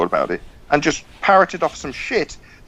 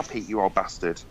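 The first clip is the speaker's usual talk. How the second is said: low and much softer, full of disdain; contemptuous jab; 9 semitones lower, 10 dB quieter than usual. Real, not acted. Co-host quietly insults Pete mid-row: contemptuous jab. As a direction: sneer it quietly at him.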